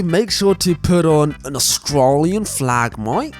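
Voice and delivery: drawn-out, hammed-up Aussie accent; bad Australian accent. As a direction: put on a clumsy Australian twang. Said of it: "I'm really sorry for my Australian accent".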